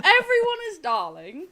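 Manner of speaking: hammed-up posh English accent with a laugh. Shown as a demonstration. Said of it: "That's how they speak when they're posh".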